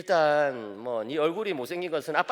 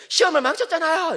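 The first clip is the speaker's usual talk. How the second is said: high, louder voice of a sulking son; whiny teen son; 13 semitones higher, 8 dB louder than usual. Retold or imitated. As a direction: play the upset teenage boy. Son's complaint voiced by dad. (투정 부리는 아들 목소리)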